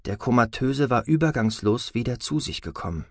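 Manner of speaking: ordinary delivery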